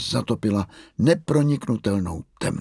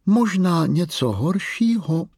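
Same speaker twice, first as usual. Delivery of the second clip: hrané strašení; 8 semitones higher, 3 dB louder, slower than usual. Acted. Mimlina dcera straší Miu.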